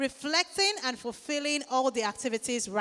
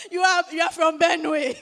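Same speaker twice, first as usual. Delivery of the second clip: laughing; 3 semitones higher, 6 dB louder than usual. Real, not acted. Laughing at guess.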